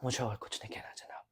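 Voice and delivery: whispered plea. Acted. Lawyer ends his appeal to the judge in a hushed whisper. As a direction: breathe the last plea out in a whisper.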